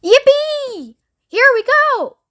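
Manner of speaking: high, loud glee; thrilled whoop. Acted. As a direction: cheer as the lift takes off. Grandpa Joe thrilled in lift.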